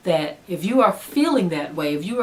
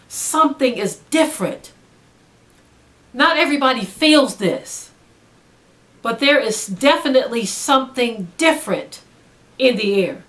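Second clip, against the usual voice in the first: rising, louder preaching push; 4 semitones higher, 4 dB louder than usual. Acted. Preacher warning the congregation, louder and higher. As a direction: lift it up like a pulpit warning.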